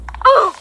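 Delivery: startled high gasp; sharp gasp. Real, not acted. Player caught by the flood, a startled gasp. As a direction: gasp in shock as the flood hits.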